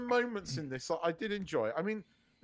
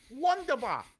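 mock German accent, higher voice; 6 semitones higher, 3 dB louder than usual. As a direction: put on a comical German accent. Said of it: "It was done in a German accent".

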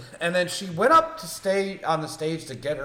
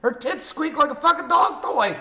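amused disbelief; 10 semitones higher than usual. Real, not acted. Laughing disbelief retelling.

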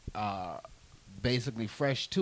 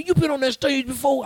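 put-on pitched up, pushy old man urging him on; hammed-up older man, higher and louder; older gentleman; 14 semitones higher, 8 dB louder than usual. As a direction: lean in like an insistent older guy hyping a young comic. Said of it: "I was like".

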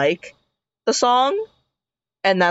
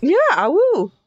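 high playful werewolf howl; wolf howl; 11 semitones higher, 3 dB louder than usual. Pretend. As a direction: howl it like the song. Werewolf song howl again.

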